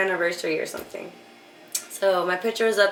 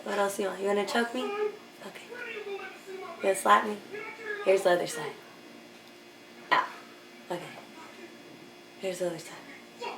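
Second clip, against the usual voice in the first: much softer, hushed and compliant; submissive murmur; 12 dB quieter than usual. Retold or imitated. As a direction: purr every offer softly like an eager servant. Submissive talk to daddy, retold.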